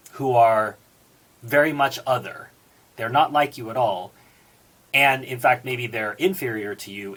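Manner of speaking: usual speaking voice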